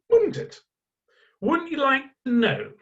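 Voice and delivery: high, coaxing tease; playful rhetorical nudge; teasing rhetorical question. Real, not acted. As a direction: dangle it like bait.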